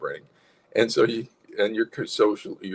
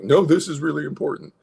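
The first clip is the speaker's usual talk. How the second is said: louder, higher urgent protest; anxious insistence; 7 semitones higher, 5 dB louder than usual. Acted. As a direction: push back anxiously, acted. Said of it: "so when you get anxious".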